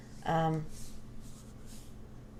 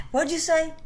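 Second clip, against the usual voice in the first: high, pushy mock dad demanding; high-pitched mock father grilling his kid; stern dad, high and insistent; 9 semitones higher, 5 dB louder than usual. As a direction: press him to repeat it, voice raised high. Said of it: "he would ask me to say it again".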